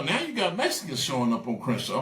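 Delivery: ordinary talk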